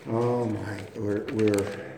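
ordinary delivery

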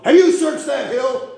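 high, loud shouted question; storyteller's sudden high-pitched shout; retold yell. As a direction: yell it up a hill, imitating a soldier. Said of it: "And the voice yelled".

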